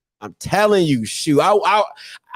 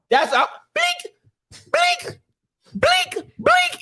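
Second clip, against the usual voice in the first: scanner beep imitation; slower than usual. Mimicking registry scanner beeps.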